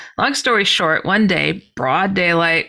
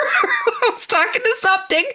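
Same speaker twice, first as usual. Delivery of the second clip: real laugh breaking into the words; high, laughing through the line. Real, not acted. Storyteller now an absolute believer, laughing.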